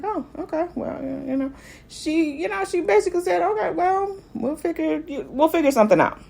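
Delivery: high, soft and hesitant; a soft, high, uncertain brush-off; hesitant, unsure reply. Retold or imitated. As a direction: brush it off softly, not sure what to say. Rhaenyra answering, unsure.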